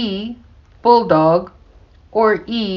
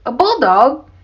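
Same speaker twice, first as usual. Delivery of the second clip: higher, teasing question; amused disbelief; 4 semitones higher, 3 dB louder than usual. A narrator's reading. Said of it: "Sam laughed".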